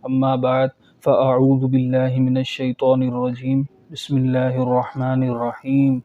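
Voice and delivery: measured, devotional recitation of the opening; formal Arabic opening. Acted. Teacher opening a Quran lesson, devout recitation. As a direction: recite the blessing calmly before class.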